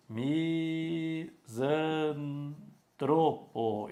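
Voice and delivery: misantropo drawn out very slowly, stress on tro. Shown as a demonstration. Teacher contrasting the right misantropo, very slowly. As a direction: stretch out misantropo, leaning on tro. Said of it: "e não misântropo".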